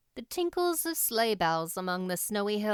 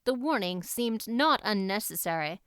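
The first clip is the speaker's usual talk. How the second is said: ordinary delivery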